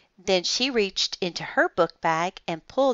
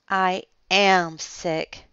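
plain reading voice

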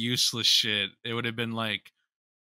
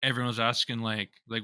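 ordinary delivery

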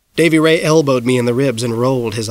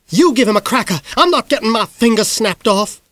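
high, indignant sister; Miss Greenglass voice; 8 semitones higher than usual. A narrator's reading. Miss Greenglass refusing her sister, raised and indignant. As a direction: snap back refusing.

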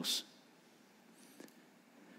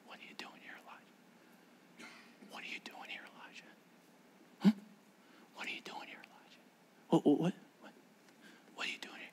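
God's whisper; 21 dB quieter, faster than usual. Acted. God whispering to Elijah on the mountain, hushed whisper. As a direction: lean in and whisper the question. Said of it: "scripture says Elijah heard a whisper".

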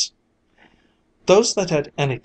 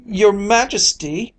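Your majesty called out high and slow; calling out; 8 semitones higher, 4 dB louder than usual. A narrator's reading. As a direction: call Your majesty across the hall. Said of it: "he called after him".

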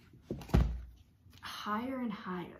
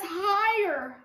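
higher and louder, eager; excited child; 9 semitones higher, 7 dB louder, slower than usual. Acted. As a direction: call out like an eager little girl. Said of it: "She says".

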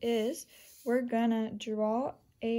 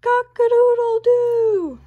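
high, loud, drawn-out crow; rooster crow; 12 semitones higher, 11 dB louder, slower than usual. A narrator's reading. Rooster waking Lulu, a loud high crow. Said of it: "somebody somewhere said".

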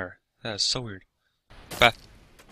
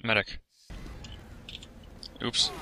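ordinary talk, nothing marked

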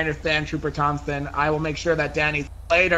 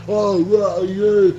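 mocking her fiance; 4 semitones higher than usual. Woman mocking her fiance's pickup line in a dopey voice. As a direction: play him as a clumsy flirt. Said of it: "He said".

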